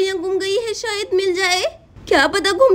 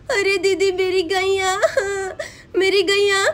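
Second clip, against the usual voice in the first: tearful. Acted. Radha to Chanda about her stolen cow, tearful. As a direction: choke out the words through tears.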